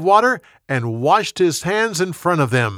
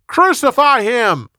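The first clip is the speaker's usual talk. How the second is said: crowd shout; 9 semitones higher, 5 dB louder, slower than usual. A narrator's reading. Crowd answers Pilate's question.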